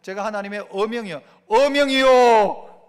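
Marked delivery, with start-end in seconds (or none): 1.5-2.8 loud, slow decree cry; full proclamation. Shown as a demonstration. Preacher demonstrating the decree call to the church, loud. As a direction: bellow it out like a royal herald. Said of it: "한번 해봐야 됩니다".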